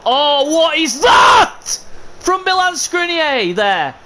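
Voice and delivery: high, loud, slow yell at a bad play; real exasperated shout; frustrated shout. Real, not acted. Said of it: "I'm sorry about shouting".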